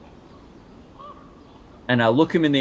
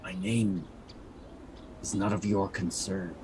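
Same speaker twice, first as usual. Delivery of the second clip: low, quiet and aloof; cold disguise; 4 semitones lower, 11 dB quieter than usual. Acted. Disguised rogue brushing off the prisoner, low and cold. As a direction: keep it cold and distant.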